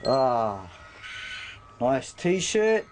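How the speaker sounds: ordinary talk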